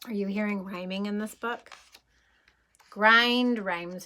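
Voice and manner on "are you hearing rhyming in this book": plain reading voice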